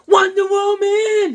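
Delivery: high, loud, drawn-out announce; excited. Real, not acted. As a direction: hype up the cover. Excited comic reveal.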